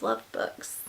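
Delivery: soft whispered confession; hushed devoted whisper. Real, not acted. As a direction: whisper it lovingly to yourself. Whispered aside about books.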